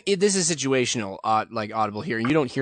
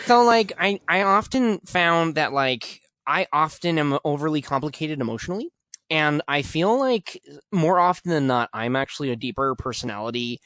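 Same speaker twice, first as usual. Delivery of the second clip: high-pitched whine through the nose; whiny nasal voice; 5 semitones higher than usual. Pretend. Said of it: "I'm just gonna try to simulate".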